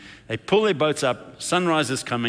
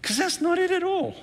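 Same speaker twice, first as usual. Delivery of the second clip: high insistence. Acted. Pastor acts out urgently correcting someone.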